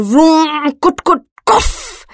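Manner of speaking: slow failing engine cough; sputtering engine. Acted. Tuk Tuk failing to start on a cold morning, sputtering. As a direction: let the engine sputter and die. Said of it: "says the engine".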